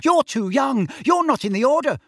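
loud, very high mocking falsetto; high-pitched mother mimicry. A narrator's reading. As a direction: mimic your mother's scolding, pitched right up. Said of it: "high-pitched voice that sounded uncannily like his mother's".

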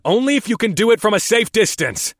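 high, loud firm reply. A narrator's reading. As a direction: answer firmly over the noise. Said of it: "I replied".

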